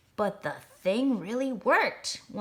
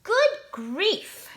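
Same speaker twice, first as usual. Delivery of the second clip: higher, louder exasperated groan; 10 semitones higher, 6 dB louder than usual. A narrator's reading. Star bellies dismayed at their rivals, groaning. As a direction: moan it in dismay. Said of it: "groaned the ones who had stars".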